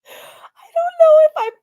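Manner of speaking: words lost in a laughing fit; laugh-crying. Real, not acted. Reactor overwhelmed by the clip, laugh-crying.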